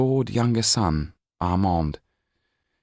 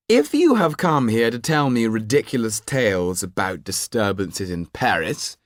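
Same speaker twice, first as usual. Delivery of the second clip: higher, arrogant nobleman cutting in; haughty Marquis; 4 semitones higher, 3 dB louder than usual. Acted. Marquis interrupting the lawyer at dinner, haughty and high. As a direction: brush him off with lofty disdain.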